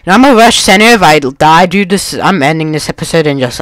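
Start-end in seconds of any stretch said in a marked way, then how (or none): none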